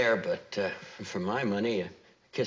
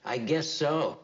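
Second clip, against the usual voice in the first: higher, raised-voice reply; louder; 8 semitones higher, 4 dB louder, slower than usual. Acted. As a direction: speak up so the room hears. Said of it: "Could you speak a little louder".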